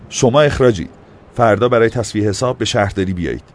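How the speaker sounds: loud stern verdict; firing them. A narrator's reading. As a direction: pronounce the dismissal firmly. Mayor fires the officials.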